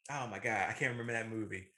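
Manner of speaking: ordinary delivery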